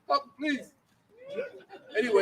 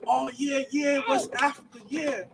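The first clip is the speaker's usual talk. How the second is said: mock clueless nodding; 7 semitones higher, 4 dB quieter, slower than usual. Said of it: "they be like".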